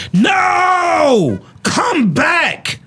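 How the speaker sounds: high, drawn-out mock scream of panic. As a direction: imitate a frantic woman screaming after them.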